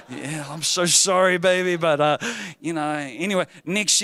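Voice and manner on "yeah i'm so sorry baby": mock sympathy. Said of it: "on the inside I was also going".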